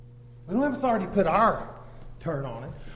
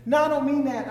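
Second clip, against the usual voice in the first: normal talking voice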